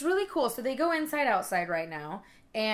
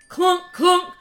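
loud, high clunk of a knock; imitated clunking; 8 semitones higher, 9 dB louder than usual. Telling how the cat paws the window, imitated clunks.

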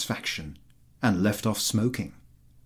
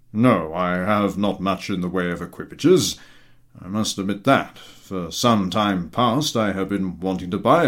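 gruff general; 2 semitones lower, 4 dB louder than usual. A narrator's reading. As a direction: grumble it out like an old general, lower. General talks about carriages.

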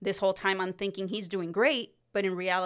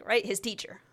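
ordinary talk, nothing marked

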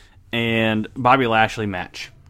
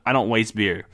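normal talking voice

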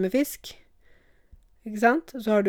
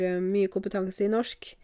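ordinary talk

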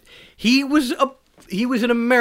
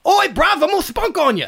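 much higher, louder cockney yob; over-the-top cockney accent, pitched up; mock cockney; 13 semitones higher, 6 dB louder than usual. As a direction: shout it like a cockney lout. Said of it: "cummed on by a cockney".

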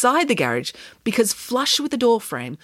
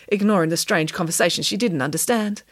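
plain reading voice

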